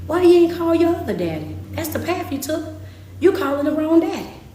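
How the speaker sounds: full-force scolding preacher voice; preaching as God. Acted. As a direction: let God rebuke the people, building heat. Preaching in God's voice.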